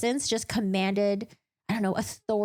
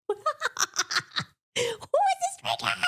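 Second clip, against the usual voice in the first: much higher, thrilled squealing question; excited squeal; 13 semitones higher than usual. Real, not acted. Fan reacting to the stranger: excited high squeal. Gushing over a book character.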